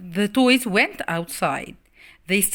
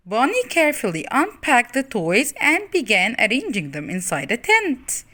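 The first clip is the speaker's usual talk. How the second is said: ordinary delivery, nothing marked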